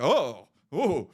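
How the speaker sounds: lightly imitated crowd "oh"; mock scandalized oohing from bystanders; onlookers reacting. Bystanders hearing a harsh insult, imitated reaction. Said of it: "I heard people on the side be like".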